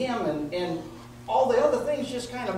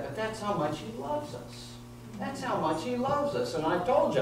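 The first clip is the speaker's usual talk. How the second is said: ordinary talk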